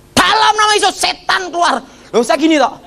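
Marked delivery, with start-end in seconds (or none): 0.1-1.8 high, forceful imitated exorcism cry; exorcist's command. Hamba Tuhan mengusir setan dari Tony, high commanding mock cry. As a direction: imitate a pastor casting out a demon, pitched way up.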